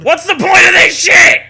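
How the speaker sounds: high, loud exasperated yell; exasperated shout. Acted. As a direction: yell it out in mock frustration. Exasperated joke outburst.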